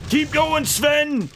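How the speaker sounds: high-pitched urging shout; mock bossy yell. Streamer pushing Sven to keep going, mock bossy yell. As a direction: drive the worker on playfully.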